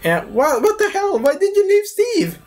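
very high falsetto protest; hammed-up high-pitched scolding; mock woman's outrage. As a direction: squeal it like an indignant woman.

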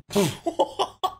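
high burst of laughter; high-pitched giggle. Real, not acted. Laughs between takes.

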